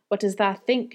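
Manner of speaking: ordinary delivery, nothing marked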